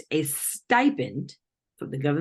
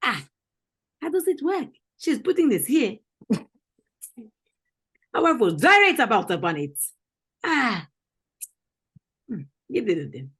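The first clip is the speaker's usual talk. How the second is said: higher-pitched mock African accent; African interrogator, accusing and incredulous; 5 semitones higher, 4 dB louder than usual. Confronting someone over found belongings, mock African accent.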